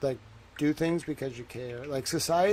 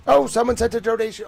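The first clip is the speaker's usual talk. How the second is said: happy surprise; 8 semitones higher, 7 dB louder than usual. Real, not acted. Donation arrives mid-stream.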